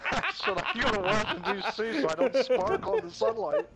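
high, loud words through laughter; laughing. Real, not acted. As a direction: blurt it out cracking up. Repeating joke while laughing.

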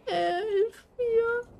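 high, soft, half laughing half crying; whimpering plea. Real, not acted. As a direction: plead weakly. Scared, pleading. (wimmernd flehend)

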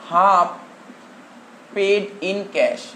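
half, then a pause, paid in cash spoken slowly; slow dictation. Shown as a demonstration. Teacher to class taking notes, slow dictation. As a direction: dictate the phrase word by word. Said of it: "লেখ".

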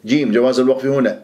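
ordinary delivery

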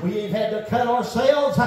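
high, shouted whooping sermon; fiery preaching. Real, not acted. Preacher whooping the Baal story, shouting. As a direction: let it ring out, chanting.

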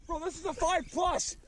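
high-pitched thrilled exclamation; real high-pitched excitement; amazed excitement. Real, not acted. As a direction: blurt it high, amazed at the catch. Amazed at fish size.

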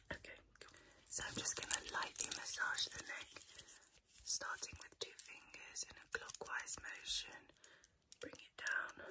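soft unvoiced ASMR whisper. ASMR whisper during massage.

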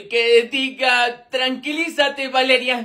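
slow, halting self-correction; corrección apenada. Acted. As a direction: backpedal slowly after a slip of the tongue. Se corrige tras decir mi amor. (corrección lenta y titubeante)

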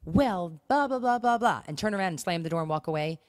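ordinary talk, nothing marked

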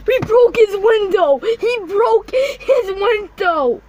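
high, loud panicked yell; alarmed shout. Real, not acted. Yelling to viewers as the clown breaks the window. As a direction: scream it out in shock.

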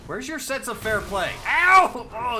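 high loud indignant yell; raging gamer. Real, not acted. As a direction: scream at the unfair enemy.

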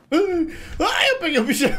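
high startled yelps into laughter; panicking gamer. Real, not acted. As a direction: shriek then laugh it off. Jump scare in horror game.

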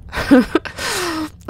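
high-pitched giggle. Real, not acted. Gamer choosing a car, giggling.

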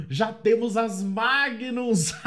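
laughing delight. Real, not acted. Comemora arma encontrada.

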